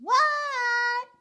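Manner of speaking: long, high, loud "what?"; yelled reply. Acted. Froggy answers his mother's call, high drawn-out yell.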